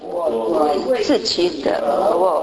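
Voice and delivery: slow, solemn Bible reading voice; scripture reading. Acted. Preacher reading God's promise to Hezekiah, slow and solemn. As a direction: read God's words slowly and with weight.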